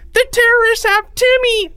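put-on very high, loud child's scream; panicked scream. A narrator's reading. Third grader alerting classmates, screaming. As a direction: shriek the alarm. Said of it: "screamed a third grader".

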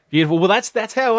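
laughing. Real, not acted. Reacts with laugh.

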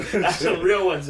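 talking through a laugh; laughing at his own pick. Real, not acted. Laughs about his greasy team.

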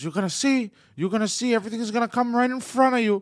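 high, loud frantic warning; screaming rant. Retold or imitated. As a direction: scream it like a conspiracy nut. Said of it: "he starts screaming".

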